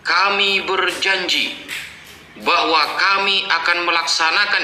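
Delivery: mock official pledge. Giving an example of plural of majesty, proclaimed pledge. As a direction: recite it like an official oath.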